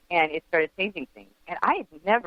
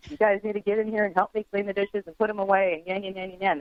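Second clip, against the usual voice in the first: nagging mom voice, pitched up; grumpy mom nagging the kids about dishes; 6 semitones higher than usual. Retold or imitated.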